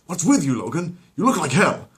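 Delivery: high, loud shocked call; startled by his state; gruff colleague. Acted. A colleague shocked at Logan, loud and high.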